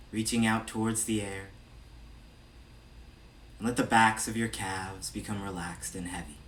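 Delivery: measured meditation-guide voice with long pauses between phrases; evenly projected, spacious meditation delivery; guided meditation. Real, not acted. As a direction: lead a body scan, unhurried and steady. Leads a body scan meditation.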